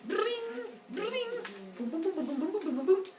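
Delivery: high, twangy string imitation; high plinks then bubbling booms; strumming noises. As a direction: sing out plucked-string sounds.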